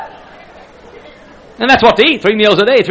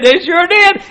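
excited answer; 14 semitones higher, 6 dB louder, slower than usual. Retold or imitated. Husband answering.

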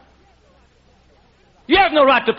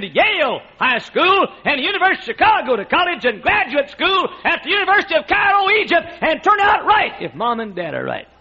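building shout; 6 semitones higher than usual. Real, not acted. Preacher rousing the congregation, rising to a shout.

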